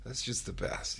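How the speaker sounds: softly voiced thought; whispered thought. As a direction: breathe it out as an inner thought. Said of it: "I thought".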